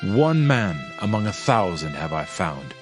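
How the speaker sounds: plain reading voice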